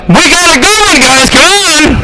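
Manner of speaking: high, put-on rallying call; gleeful evil spirit. Evil spirit telling everybody else while you sleep, gleeful. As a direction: call the gang over in a high mock spirit voice. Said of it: "this evil spirit's telling everybody else".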